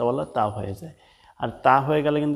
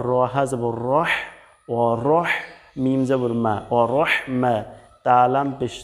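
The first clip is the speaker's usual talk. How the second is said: ordinary talk, nothing marked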